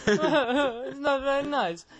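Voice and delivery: put-on high, drawn-out Italian accent with a laugh; Italian bloke impression. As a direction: stretch it out in a mock Italian whine. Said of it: "What, of the Italian bloke?".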